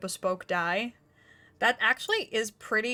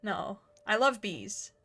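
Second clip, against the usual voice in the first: usual speaking voice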